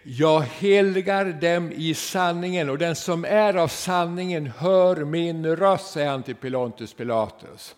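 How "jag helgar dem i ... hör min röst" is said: slow, loud declaiming; solemn scripture quote. Acted. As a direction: intone the words of Jesus slowly. Quoting Jesus in sermon.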